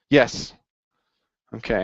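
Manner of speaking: ordinary delivery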